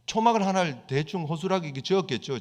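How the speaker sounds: normal talking voice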